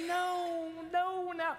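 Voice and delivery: high, drawn-out comic wail of refusal; mock dismay. Staff kid dreading the unwanted classmate's return, mock dismayed wail. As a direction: wail it high, held on one note.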